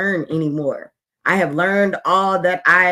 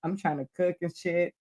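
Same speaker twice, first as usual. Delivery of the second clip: ordinary talk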